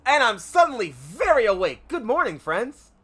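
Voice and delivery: loud, high, bursting exclamation; over-eager shouted good morning; suddenly wide awake. Acted. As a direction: jump in at full volume with a hearty greeting. Startled sleeper jumps up awake.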